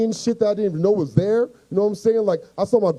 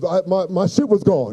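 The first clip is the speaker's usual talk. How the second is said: ordinary delivery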